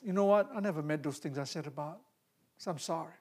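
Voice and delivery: soft, low remorseful words; hushed dying man's sorry; quiet retold apology. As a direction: speak like a man making peace.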